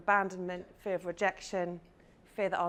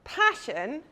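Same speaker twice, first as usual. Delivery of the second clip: ordinary delivery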